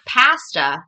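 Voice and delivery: pasta with a hard, aggressive first syllable; pasta said her way. Real, not acted. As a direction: hit the start of pasta loudly. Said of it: "apparently I say that very weird".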